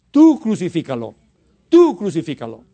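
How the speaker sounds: loud punch on "Tú", falling away; acted divine command. Preacher voicing the Lord's answer about bad temper, firm command. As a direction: order it firmly, hitting "Tú" hard.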